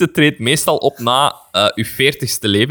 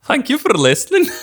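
higher, sing-song fake Scottish sign-off; mock Scottish accent; 10 semitones higher than usual. Podcast host signing off in a mock Scottish accent. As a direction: play a Scot thanking the audience. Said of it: "Dat was bekend Schot".

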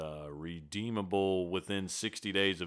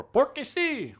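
high, loud Spanish "porque sí"; Spanish phrase; 5 dB louder, slower than usual. Retold or imitated. Retelling what Spanish speakers say, in a high Spanish voice. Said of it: "what they'd say in Spanish".

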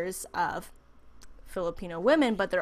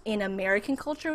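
usual speaking voice

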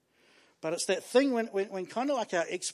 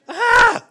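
frustrated scream; 13 dB louder than usual. Retold or imitated. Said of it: "it's like".

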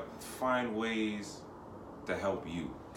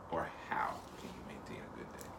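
whispering; 14 semitones lower, 15 dB quieter, faster than usual. Real, not acted. Whispered rephrasing.

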